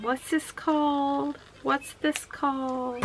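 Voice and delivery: put-on high, drawn-out child's question; slow sing-out of a child's repeated question; imitating little girl. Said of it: "she keeps saying".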